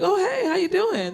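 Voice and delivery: high bright fake office greeting; mock friendly hello to a coworker; fake cheery greeting. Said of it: "I'm like".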